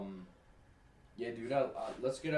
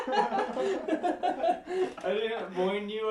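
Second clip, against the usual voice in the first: laughing through the words; giggling hard while talking; 8 semitones higher, 6 dB louder, much slower than usual. Real, not acted. Friend laughs at drunk rambling.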